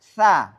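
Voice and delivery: light lisped start on "Sa"; thin tha. Shown as a demonstration. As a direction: keep the vowel of "Sa" thin. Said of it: "sehingga bunyinya tipis".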